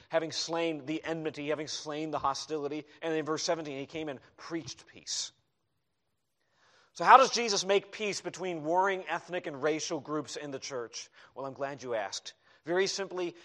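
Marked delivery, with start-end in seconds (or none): none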